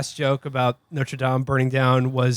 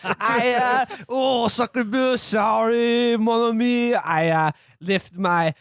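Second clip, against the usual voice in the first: higher, exaggerated French worker; French accent; 6 semitones higher than usual. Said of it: "now here's my bit".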